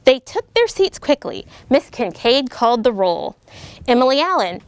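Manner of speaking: lively storybook read-aloud voice; bouncy rhyming picture-book reading; reading to children. A narrator's reading. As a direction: read it like story time for second graders.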